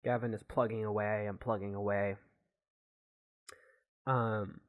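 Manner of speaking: ordinary talk, nothing marked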